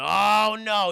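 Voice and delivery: loud, drawn-out storyteller's oh; mock-dramatic dread. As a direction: cry out in mock alarm.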